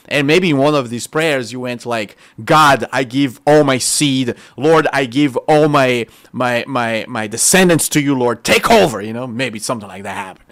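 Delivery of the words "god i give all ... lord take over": louder imagined prayer offering descendants to God; fervent prayer. Pretend. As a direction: pour your seed out to God, pleading.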